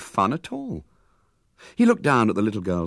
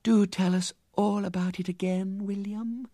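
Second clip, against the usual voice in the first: tender; 12 semitones higher than usual. Acted. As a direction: coax him tenderly. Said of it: "sinking her voice to tenderness".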